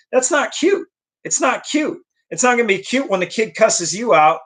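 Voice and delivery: high, indignant disapproval; not cute. Real, not acted. Vlogger scolding parents who find cussing cute, high and indignant. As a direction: scold the parents, pitch jumping up high in disbelief.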